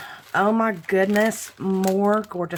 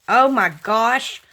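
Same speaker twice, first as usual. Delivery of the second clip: normal talking voice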